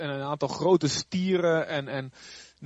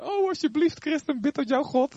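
much higher, hammed-up begging colleague; mock pleading; 10 semitones higher than usual. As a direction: play a scared unbeliever begging. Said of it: "moeten ze jou vragen". (gespeelde smekende collega)

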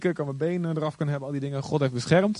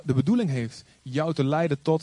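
ordinary talk

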